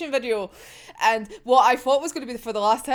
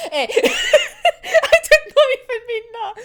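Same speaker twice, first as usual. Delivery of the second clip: laughing through the words, high and loud; giggling, slow words through a fit of laughter; cracking up laughing; 12 semitones higher, 7 dB louder than usual. Real, not acted. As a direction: speak while breaking into laughter. Cracking up at own joke.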